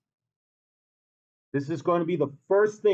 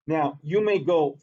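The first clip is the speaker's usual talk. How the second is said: usual speaking voice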